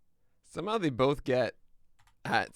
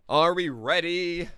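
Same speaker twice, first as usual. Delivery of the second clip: drawn-out, high, loud call; stretched, raised-voice kickoff question; loud warm-up call; 6 semitones higher, 9 dB louder, slower than usual. Real, not acted. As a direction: call it out long and big, testing the voice. Warming up voice to start stream.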